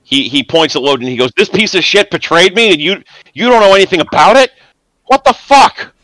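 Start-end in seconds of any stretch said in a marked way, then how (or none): 1.4-5.9 higher and louder, rising into a shout; furious outburst. Acted. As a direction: spit it out in betrayed rage. GM voices furious NPC accusing.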